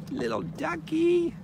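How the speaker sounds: very high, slow cooing voice. Real, not acted. Woman coos in a very high voice to a duck.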